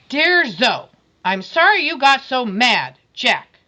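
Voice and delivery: put-on a sulky boy forced to apologise; grumpy boy reading. Boy grudgingly writing to Zoe, loud and sulky. Voicing Jack's grudging note.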